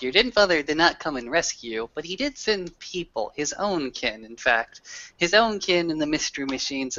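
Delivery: ordinary delivery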